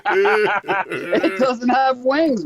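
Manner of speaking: slow words through laughter; laughing while talking. Real, not acted. Laughing at the chicken joke.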